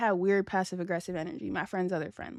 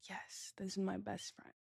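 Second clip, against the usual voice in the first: whisper; 16 dB quieter than usual. Real, not acted. Whispering about friend beside her.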